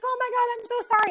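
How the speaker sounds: high, gushing mock apology; teasing imitation of an over-the-top sorry; mimicking neighbor. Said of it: "And she's like".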